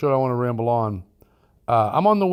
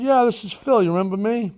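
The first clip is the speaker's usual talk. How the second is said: higher-pitched, mildly upset caller; a little upset; 10 semitones higher than usual. Retold or imitated. Retired Mason phones the buyer who took his property, mildly upset. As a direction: imitate a slightly upset man phoning back. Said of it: "he sounded a little, little upset".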